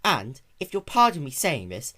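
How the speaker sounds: Rarity lecturing Spike while pinning a hem. A narrator's reading. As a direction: correct him gently but firmly. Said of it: "Rarity lectured".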